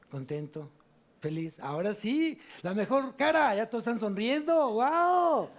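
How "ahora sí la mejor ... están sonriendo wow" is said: building up to a loud, high joyful wow; rising cheer that bursts into a high loud wow; excited cheer. Acted. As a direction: lift the crowd, ending in a big excited wow. Preacher urges everyone to smile.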